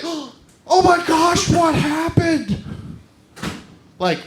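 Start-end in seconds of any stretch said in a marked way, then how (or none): 0.0-2.6 slow, high, drawn-out pity; slow, higher hammed-up shock at the news; mock shocked concern. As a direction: overplay the horrified sympathy. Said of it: "people will be like".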